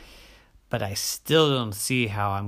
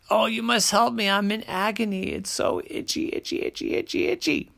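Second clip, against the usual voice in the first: high, frantic mock agony; hammed-up itchy desperation; agonized itchy bear; 9 semitones higher, faster than usual.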